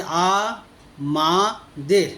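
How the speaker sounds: আ, মা, দের said as three separate beats with pauses; broken into syllables. Shown as a demonstration. Teacher splitting a word into syllables for students, paused. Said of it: "এই শব্দটা তিনবার ভাঙা যাচ্ছে".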